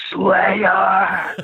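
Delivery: high, drawn-out reenacted concert scream; top-of-lungs scream. Fan screaming a band name to the singer at an outdoor festival, top-of-lungs scream. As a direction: scream it as if yelling up to a stage. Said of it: "at the top of my lungs, I screamed".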